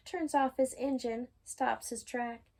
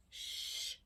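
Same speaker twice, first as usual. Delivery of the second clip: unvoiced hush; whispered shush; 10 dB quieter than usual. Reader hushing the listener, whispered shush. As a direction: shush as the story ends. Hushing before good night.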